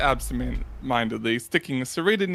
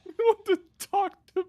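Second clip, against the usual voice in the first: much higher, silly mock demand; squeaky mock voice; 13 semitones higher than usual. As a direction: shout it in a cartoon voice. In-character shouted demand.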